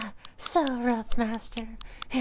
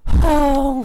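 loud moan; 3 semitones higher, 12 dB louder than usual. Acted. Outcry in roleplay.

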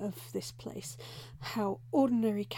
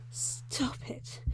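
ordinary delivery

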